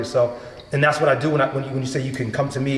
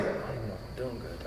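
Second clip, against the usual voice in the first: insecure murmur; 6 semitones lower, 17 dB quieter than usual. Retold or imitated. Doubting himself on set.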